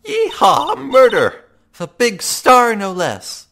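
very high, loud cowgirl whoop; excited yeehaw. Acted.

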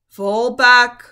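loud ordering lion; commanding lion. A narrator's reading. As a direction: order them back firmly. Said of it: "said Aslan".

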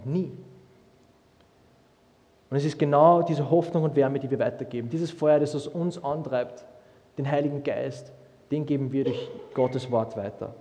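ordinary talk, nothing marked

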